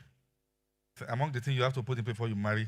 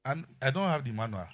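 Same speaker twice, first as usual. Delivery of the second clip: ordinary talk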